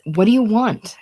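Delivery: angry, frazzled outburst; crying out. A narrator's reading. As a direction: cry it out at the baby. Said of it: "crying out".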